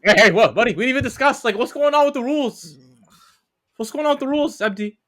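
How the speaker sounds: high, loud exasperated protest. Real, not acted. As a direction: call out the rule-breaker loudly. Protests teammate jumping in.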